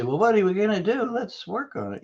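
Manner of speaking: high, quick cheerful offer; eager happy guy. Retold or imitated. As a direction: jump in brightly to help. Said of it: "me, the happy guy".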